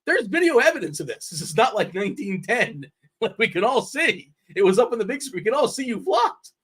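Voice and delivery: high-pitched, exasperated retold rant; mock yelling. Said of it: "yelling at him".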